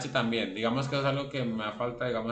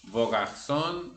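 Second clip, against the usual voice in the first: "Vos défis" said slowly, with no S sound in "Vos"; slow example; much slower than usual. Shown as a demonstration. Teacher giving an example of the silent S, slowly and clearly. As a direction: drag out "Vos défis" as a model phrase. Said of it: "se pronuncia vo".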